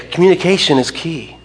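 Communication is key, with the stress on Communication; slogan. Retold or imitated. Pastor repeating counselors' advice, slogan-like stress. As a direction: recite it like a slogan. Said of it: "they will say".

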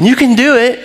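root for dad like a fan; cheering on. Retold or imitated. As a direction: kid cheering dad on, excited. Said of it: "Nathan's going".

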